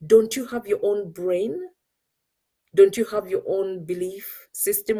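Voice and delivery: ordinary delivery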